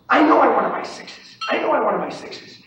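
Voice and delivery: insistent self-talk, starting high and loud; retold self-talk. Trader telling himself and his wife, retold insistent self-talk. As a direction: retell urging yourself on, emphatic and falling. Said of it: "I'll say to myself".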